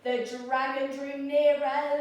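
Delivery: ordinary delivery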